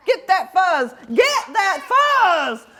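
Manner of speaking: rising from a call to a slow, high shout; slow, high battle cry; building battle cry. Acted. Prairie dogs fight over fuzz.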